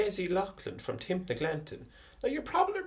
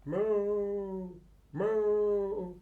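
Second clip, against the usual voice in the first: cow moo. Acted. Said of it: "recognise the noise".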